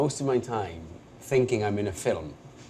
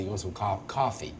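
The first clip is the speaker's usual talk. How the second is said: Brooklyn "coffee" as open "cah-fee"; Brooklyn accent; 5 dB quieter than usual. Shown as a demonstration. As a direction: demonstrate Brooklyn's open first vowel in "coffee". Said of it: "so a Brooklyn accent might say".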